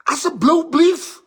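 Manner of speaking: slow high begging asseblief; drawn-out please. Retold or imitated. Friend begs teller to leave his love alone, slow high plea. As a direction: stretch the asseblief out, pleading high.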